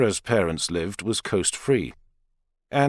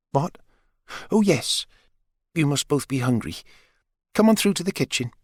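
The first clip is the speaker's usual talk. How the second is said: flirty mother; 5 semitones higher than usual. A narrator's reading. Maggie flirts with Richard, lightly voiced and dreamy. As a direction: bat your eyelashes with your voice.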